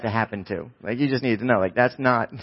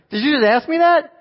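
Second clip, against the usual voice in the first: very high, louder, acted astonishment; incredulous; 6 dB louder than usual. Chaperone to girl who asked for a movie, hammed-up incredulous. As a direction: question it in hammed-up disbelief. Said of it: "I couldn't believe it".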